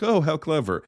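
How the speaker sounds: high-pitched admiring parent; impressed parents. Acted. Parents reacting to the movie's concept, impressed. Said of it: "all the parents are gonna be like".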